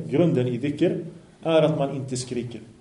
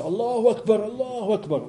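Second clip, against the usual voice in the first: imitated shouting; 7 semitones higher than usual. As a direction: mimic people crying out the takbir, held back. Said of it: "går och skriker så".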